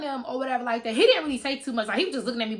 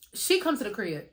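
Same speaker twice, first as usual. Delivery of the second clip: plain reading voice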